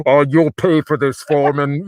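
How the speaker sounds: loud, hammy threat; villain voice. Retold or imitated. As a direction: snarl it like a cartoon villain. Acting his villain line.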